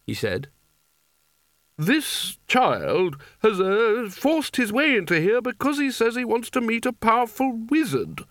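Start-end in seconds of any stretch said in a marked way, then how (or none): 1.8-8.3 high, slow, prissy disapproving wizard. Acted. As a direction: sniff at the child's request from up high. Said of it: "said Spelter disapprovingly".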